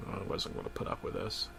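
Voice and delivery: hurried, hushed resolve; fast whisper. Acted. Narrator whispering his decision to himself. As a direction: rush it under your breath.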